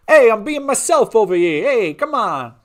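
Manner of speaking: very loud, high, hammed-up Italian mobster; mobster Italian accent. Mobster protesting to chat, loud mock Italian accent. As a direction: protest with mock mafia bluster.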